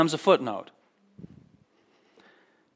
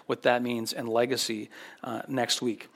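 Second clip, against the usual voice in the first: usual speaking voice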